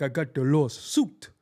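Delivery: mock put-on accent on lawsuit; Sean. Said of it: "depending on the environment, if he's like".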